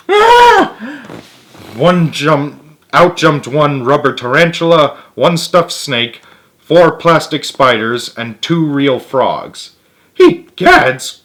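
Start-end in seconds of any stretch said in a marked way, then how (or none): 0.1-0.7 very high, loud shriek of fright; startled scream. A narrator's reading. George screaming at the rubber spiders in the box. 10.1-11.2 high, loud startled exclamation; crying out. A narrator's reading. George crying out after being tricked by Martha. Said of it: "cried George".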